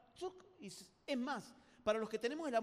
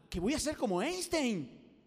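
loud, slow, hammed-up delight; ilusión exagerada; 6 dB louder, slower than usual. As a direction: draw it out as if flattered. Acts delighted at insult. (ilusión exagerada y alargada)

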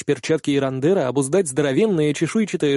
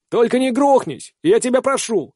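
shouted warning; 8 semitones higher than usual. Acted. Hal calling to Kihara over wind.

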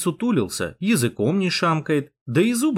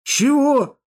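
high-pitched, astonished question; stunned; 13 semitones higher, 4 dB louder than usual. A narrator's reading. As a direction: blurt it out high in disbelief. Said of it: "сглотнув, пробормотал Миша".

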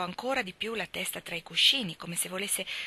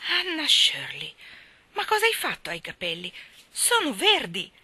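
shocked outcry; 8 semitones higher, 5 dB louder than usual. Acted. Marilla sees Anna's green hair, shocked outcry. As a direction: gasp it out, appalled.

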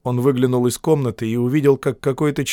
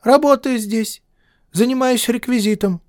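put-on thin, high lisping boy's voice; a thin lisping teenage boy; slushy lisp; 11 semitones higher than usual. A narrator's reading. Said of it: "с тонким шепелявым голосом".

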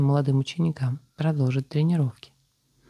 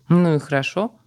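cheered up; 3 semitones higher, 4 dB louder than usual. Acted. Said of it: "воодушевился собеседник".

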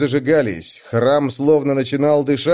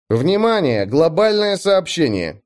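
announcer voice; 5 semitones higher than usual. A narrator's reading. System announcement in game.

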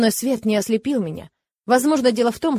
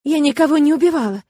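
frightened babble; 6 semitones higher, 5 dB louder than usual. Acted. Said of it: "пролепетала она перепуганным голосом".

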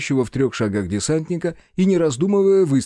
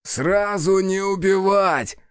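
high, drawn-out shouted order; long high yell of command; marauder's shout; 5 semitones higher, much slower than usual. A narrator's reading. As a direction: call it out slowly to the whole gang. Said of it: "крикнул один из мародёров".

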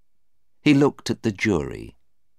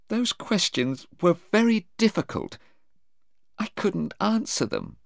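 high, upset complaint; a plaintive, softening high voice; tearful. A narrator's reading. Sarah telling her mother about the questioning, tearful. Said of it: "Sarah cried at first".

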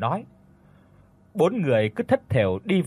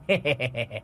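mocking snicker; leering chuckle; 3 dB quieter than usual. A narrator's reading. Villains mocking the girl.

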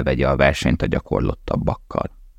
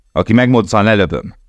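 higher, louder barked command; barked threat; 5 semitones higher, 4 dB louder than usual. A narrator's reading. SS guard threatening the prisoners, barked loud. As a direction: bark it like a threat. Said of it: "vakkantott az S S".

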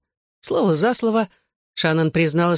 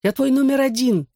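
high-pitched indignant declaration; theatrical huff; 8 semitones higher than usual. A narrator's reading. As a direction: protest dramatically, pitched right up. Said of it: "театрально засопел он". (театрально возмущённо)

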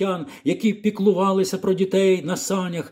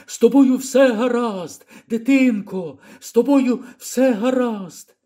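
relieved call; 8 semitones higher than usual. Acted. Old man relieved to see the girl.

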